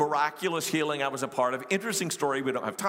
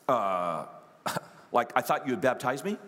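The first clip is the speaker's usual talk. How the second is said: hesitant Jeff; 6 semitones lower than usual. Retold or imitated. Acts out Jeff's hesitant answer.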